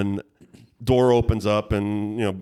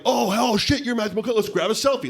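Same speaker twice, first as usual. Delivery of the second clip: starstruck gushing; 12 semitones higher than usual. Pretend. Imagined starstruck fan gushing.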